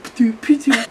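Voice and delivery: little spat pitoot sounds; spitting noise. Retold or imitated. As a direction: imitate someone spitting. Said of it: "spitting for a while".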